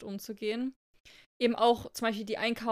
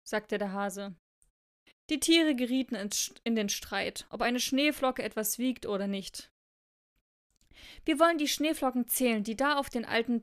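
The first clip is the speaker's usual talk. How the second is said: expressive read-aloud with deliberate phrasing; story narration. A narrator's reading. Reading a snowflake fable to co-host, expressive storytelling.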